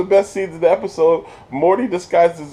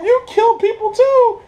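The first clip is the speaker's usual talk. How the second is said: high mock indignant; 4 dB louder than usual. Planetina voiced.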